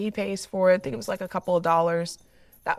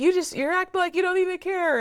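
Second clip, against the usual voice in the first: put-on much higher, upset child's accusing voice; upset daughter; 12 semitones higher, 3 dB louder, faster than usual. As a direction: imitate the hurt daughter's accusation, pitched up high. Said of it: "she's like".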